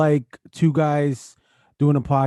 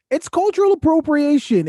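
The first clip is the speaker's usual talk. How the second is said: emphatic high; 12 semitones higher than usual. Real, not acted. Explaining, voice jumps up.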